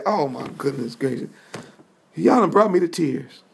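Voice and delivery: tearful. Acted. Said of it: "And Yeshua went to weeping".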